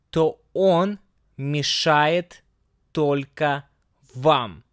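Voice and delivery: slow, deliberate Russian sentence; slow careful reading. Shown as a demonstration. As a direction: read each word slowly with pauses. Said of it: "And again".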